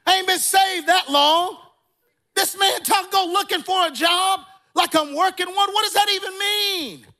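high, loud retold bewilderment; confused outburst. Preacher reliving his reaction to odd advice, loud confused protest. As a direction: act out baffled protest at full pitch. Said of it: "No, I was confused".

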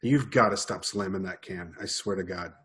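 ordinary talk, nothing marked

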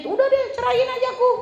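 high, sulky demand to be divorced; mock whiny wife. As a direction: imitate a pouting wife daring her husband. Nagging wife threatens divorce.

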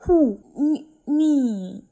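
loud, high indignant disbelief; shocked at being told to marry; incredulous outburst. Real, not acted. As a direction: yelp it like you can't believe they said it. Outraged at marriage advice.

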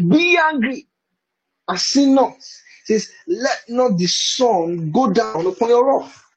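high-pitched, drawn-out scripture recital; imitating others' Bible reading. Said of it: "the way I've heard people read it".

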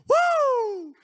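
loud high shout of joy; joyful whoop. Real, not acted. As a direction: let out a joyful church whoop. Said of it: "I feel that in my soul".

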